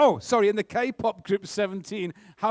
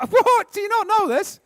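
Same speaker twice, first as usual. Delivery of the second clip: high-pitched disbelief, breaking from a laugh; incredulous; 10 semitones higher, 4 dB louder than usual. Real, not acted. Quizmaster to stumped contestants on an easy question, incredulous. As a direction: leap up high in amused disbelief. Quizmaster amazed nobody knows answer.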